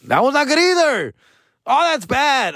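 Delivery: high-pitched, comic dismay; exclaimed realization. Real, not acted. Comedian realizing his substitute word is bad too, high exclamation. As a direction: cry it out as it dawns on you.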